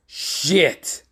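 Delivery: hammed-up shocked Jedi; alarmed curse. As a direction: blurt it in sudden panic. Jedi realises he's outmatched.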